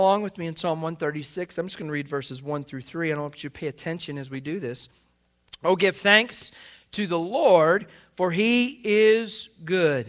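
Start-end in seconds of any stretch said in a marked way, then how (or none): 5.6-10.1 slow, raised, ringing proclamation; loud, high, deliberate praise; exultant scripture reading. Real, not acted. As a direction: proclaim the psalm loudly, stretching the words. Reads psalm aloud to congregation.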